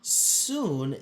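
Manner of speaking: plain reading voice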